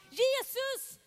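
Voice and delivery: loud, high desperate cry; shouting. Acted. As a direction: cry out with all your might to be heard. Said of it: "Och så ropar han".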